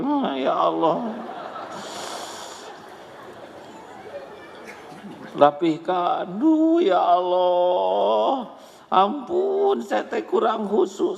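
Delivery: hammed-up bored worshipper dragging each plea; mock weary prayer. Mother praying lazily to God, mock sighing whine.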